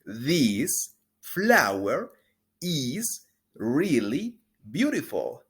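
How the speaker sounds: drawn-out pronunciation model; slow model sentence. Shown as a demonstration. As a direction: stretch every word for repeating. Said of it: "Una vez más".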